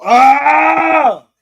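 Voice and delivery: long, loud high-pitched yell; exasperated "aaah"; frustrated scream. Real, not acted. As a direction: let out a drawn-out scream of exasperation. Exasperated at replayed clip.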